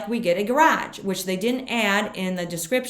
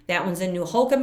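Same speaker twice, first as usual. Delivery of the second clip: ordinary talk, nothing marked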